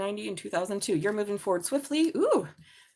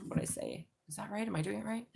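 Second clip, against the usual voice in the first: whispered, muttered self-question; whispered aside; 9 dB quieter than usual. Real, not acted. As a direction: whisper it to yourself in doubt. Unsure about the years.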